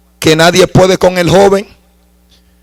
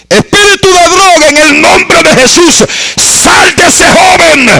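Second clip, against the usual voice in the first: preacher orders the drug spirit out, shouting high; rebuking shout; 13 semitones higher than usual. Retold or imitated. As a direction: cast the spirit out with full force. Casting out the demon, shouted.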